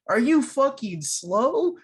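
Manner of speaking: genuinely annoyed at how dumb she is; exasperated rant. Real, not acted.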